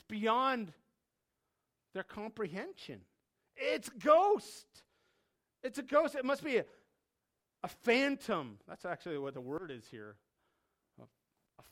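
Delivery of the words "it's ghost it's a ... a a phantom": high, loud, halting alarm; terrified cry. Acted. Disciples out on the dark water, terrified cries. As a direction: cry it out in fright, groping for the word. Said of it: "they screamed out literally".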